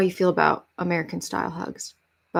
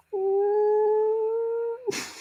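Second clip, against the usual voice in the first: high laugh; 14 semitones higher than usual. Real, not acted. Overwhelmed reaction to donation total.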